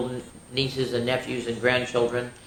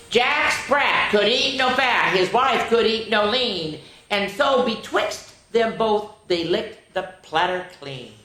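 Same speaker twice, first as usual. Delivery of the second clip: nursery rhyme recital; 6 semitones higher, 4 dB louder, slower than usual. Acted. Reads nursery rhyme aloud.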